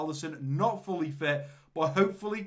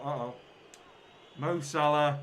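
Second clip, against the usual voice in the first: normal talking voice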